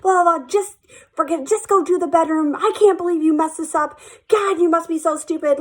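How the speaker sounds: high-pitched mock scolding; imitated angry boss. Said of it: "she was so angry with me".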